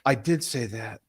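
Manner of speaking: quiet, low imitated admission; softly retold moment of realization; dawning realization. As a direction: let it sink in as you admit it. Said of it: "a come to Jesus moment".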